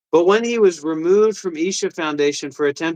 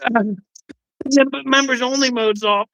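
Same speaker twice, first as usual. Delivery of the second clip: higher whiny mock voice; mocking chatter; 7 semitones higher than usual. Mocking a chat viewer in a higher whine. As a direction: sneer at a viewer's lame excuse.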